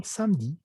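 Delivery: "samedi" in two syllables, sam-di; silent E. Shown as a demonstration. Teacher showing the mute E, two syllables. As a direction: drop the E in "samedi". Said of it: "lo correcto sería samedi".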